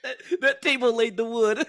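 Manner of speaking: high, loud, laughing joke; real laughter. Real, not acted. Co-host jokes about the table, laughing. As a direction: crack up on your own punchline. Listener joking amid laughter.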